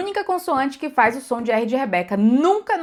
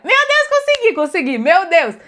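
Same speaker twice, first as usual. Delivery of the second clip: squealing much higher with joy; thrilled outburst; 7 semitones higher, 4 dB louder than usual. Retold or imitated. Retelling her first whistle to students: higher excited cry. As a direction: relive the moment it finally worked.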